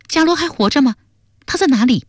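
high-pitched, startled questions; taken aback. Acted. Duan Sengnu hears her friend may be alive, taken aback. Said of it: "段僧奴一愣， 问道".

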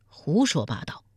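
usual speaking voice

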